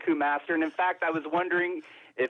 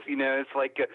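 usual speaking voice

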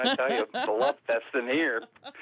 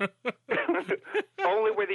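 laughing; 8 semitones higher, much slower than usual. Real, not acted. Joking about Americans, laughing.

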